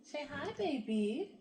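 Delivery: coaxing the dog to greet, sweet and slow. Real, not acted. Owner coaxes her dog to greet viewers, soft slow baby talk.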